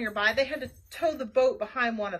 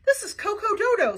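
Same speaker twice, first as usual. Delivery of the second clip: high, loud, crazed caller; crazy voice; 8 semitones higher, 7 dB louder than usual. A narrator's reading. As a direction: sound like a frantic oddball on the phone. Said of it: "said a crazy voice".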